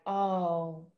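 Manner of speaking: ordinary talk, nothing marked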